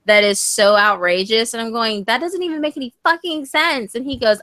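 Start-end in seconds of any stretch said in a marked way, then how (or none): 2.0-3.9 high-pitched mock exasperation; exasperated. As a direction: protest in a higher, put-out voice. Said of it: "when he's making me angry".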